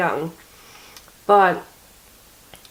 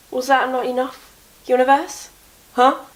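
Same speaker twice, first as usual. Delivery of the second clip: mock indignant; 5 semitones higher than usual. Real, not acted. Scolding the universe.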